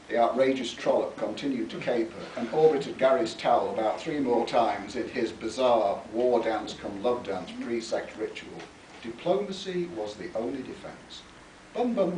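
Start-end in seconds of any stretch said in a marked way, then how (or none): none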